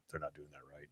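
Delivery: whispered fan complaint; whispering that they got it wrong; grumbling whisper. Retold or imitated. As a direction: mutter it under your breath like a sulking fan.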